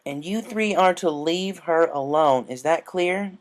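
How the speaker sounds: a hint of a stern big sister, slow; stern instruction. A narrator's reading. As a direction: lay down the rule firmly to the kids. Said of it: "A J sternly instructed".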